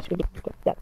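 whisper. Real, not acted. Drops to unvoiced aside.

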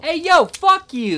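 high, loud and slow offended yell; indignant shout. Pretend. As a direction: shout back, insulted. Insulted by NPC.

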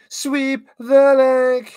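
high, slow, loud command; mock coach yell. Commentator playing a coach to Johnny, mock yell.

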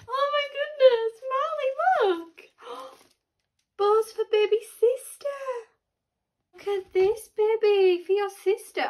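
high, cooing amazement. Real, not acted. Mum shows toddler his sister's bows, excited cooing. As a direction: marvel in a high voice for a little one.

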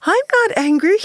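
high-pitched mimicked mother's voice; imitated mother's denial. Girl imitating her mother denying anger, high mock-mother voice. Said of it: "she'd imitate the mother's voice".

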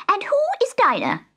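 very high squawky bird; a shrill, high parrot question. A narrator's reading. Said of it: "asked the parrot".

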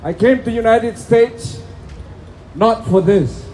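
usual speaking voice

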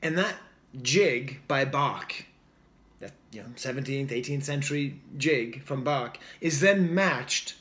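normal talking voice

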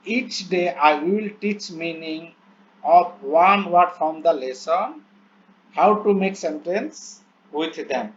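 very slow, word-by-word English reading; slow dictation reading. Shown as a demonstration. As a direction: read each English word out slowly as if dictating. Reads English plan aloud slowly. (ধীরে ধীরে শ্রুতলিপির মতো পড়া)

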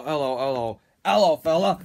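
normal talking voice

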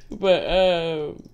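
settling back down; laugh fading out. Real, not acted. Reader getting over her laugh.

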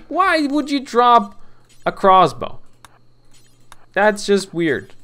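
can't believe what you just saw; loud high-pitched why would you; incredulous outburst. Real, not acted. Colonist drops crossbow.

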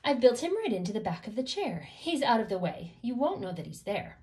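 usual speaking voice

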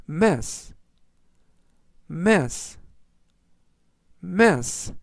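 mess read slowly, with a falling end; slow word reading. Shown as a demonstration. Teacher reviewing today's words with learners, slowly. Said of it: "제가 천천히 세 번씩 읽어드리고요".

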